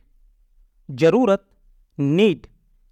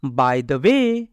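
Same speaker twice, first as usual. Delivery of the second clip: by the way stretched out very slowly; slow dictation pace on by the way; slow, careful repeat; 6 semitones lower, much slower than usual. Shown as a demonstration. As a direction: drag out by the way word by word for learners. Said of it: "teacher repeats vocabulary word slowly".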